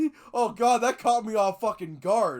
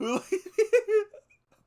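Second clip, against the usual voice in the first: high-pitched burst of laughter; 5 dB louder than usual. Real, not acted. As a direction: lose it completely. Cracking up at text.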